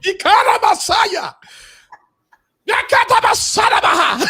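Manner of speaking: high, loud mock tongues outburst; mock speaking in tongues. Host joking they speak in tongues, mock-fervent shout. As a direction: shout nonsense syllables like a fervent worshipper. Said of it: "I know how to speak in tongues".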